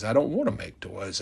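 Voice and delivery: soft, low little-elf refusal; timid refusal. Retold or imitated. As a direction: mumble it like a shy kid. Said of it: "Hermie says".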